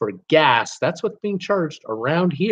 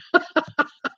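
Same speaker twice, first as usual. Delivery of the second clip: high laugh; 13 semitones higher than usual. Real, not acted. Laughs at wife not filling cars.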